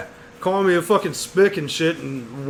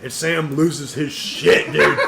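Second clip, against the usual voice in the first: ordinary delivery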